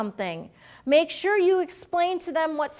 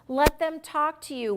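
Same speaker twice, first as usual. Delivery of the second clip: ordinary talk, nothing marked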